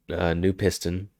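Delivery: ordinary talk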